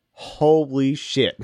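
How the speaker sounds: slow, stunned holy shit; drawn out like still high on gas; dazed awe. Retold or imitated. Patient recalls his note about the nitrous, slow and stunned.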